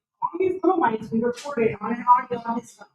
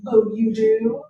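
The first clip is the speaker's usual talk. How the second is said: mock surprise; 11 semitones higher, 5 dB louder, much slower than usual. Retold surprised reply to director.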